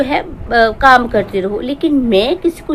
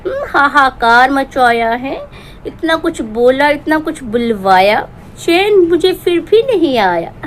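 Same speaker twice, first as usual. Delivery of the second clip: ordinary talk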